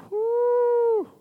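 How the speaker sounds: high, loud joyful whoop. Real, not acted. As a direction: let out a happy woo. Preacher whooping at glory.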